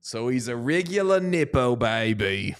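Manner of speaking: put-on a light Australian accent on the joke; lightly Australian, vowels bent. Co-host mocks the nepo baby news in an Australian accent. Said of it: "That's such a good Australian accent".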